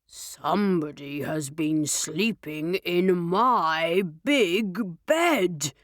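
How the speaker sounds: low, slow, gruff bear; big papa bear voice; deep, gruff Daddy Bear. A narrator's reading. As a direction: call it out deep and gruff, unhurried. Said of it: "gruff voice".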